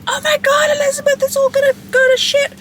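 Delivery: high shrieking mock panic. Wife panicking at Elizabeth, hammed-up shriek. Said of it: "If you're like".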